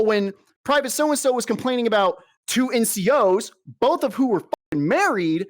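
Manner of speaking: indignant, high-pitched sarcastic rant; worked-up sarcasm. Real, not acted. Soldier wanting to answer the colonel's speech, worked-up sarcasm. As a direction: throw the phrase back at them, pitch riding high. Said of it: "I'm getting worked up".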